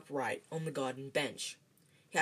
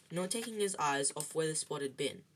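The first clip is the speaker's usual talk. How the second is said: ordinary delivery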